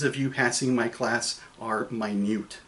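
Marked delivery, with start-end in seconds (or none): 1.9-2.6 minute said as my-NEWT, stressed on the end; stress on second half. Shown as a demonstration. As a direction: put the weight on the second syllable of minute. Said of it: "If I put the emphasis".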